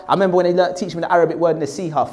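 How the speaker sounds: ordinary talk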